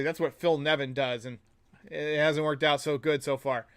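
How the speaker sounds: ordinary talk, nothing marked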